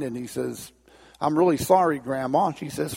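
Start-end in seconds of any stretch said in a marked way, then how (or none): none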